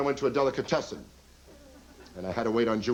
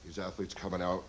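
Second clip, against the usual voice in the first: usual speaking voice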